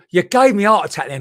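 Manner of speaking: a real jolt of alarm; startled outburst. Real, not acted. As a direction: blurt it out, truly caught off guard. Startled by a caller's name.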